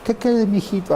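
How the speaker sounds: put-on high, sing-song baby talk to a toddler; mock baby talk. Parent imitated cooing baby talk to a toddler. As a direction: coo at the little one like doting parents. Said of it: "empiezan a hablar así".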